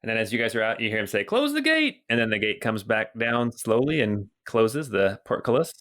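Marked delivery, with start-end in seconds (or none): 1.2-2.0 calling out. Acted. Guard ordering the gate shut, high-pitched call.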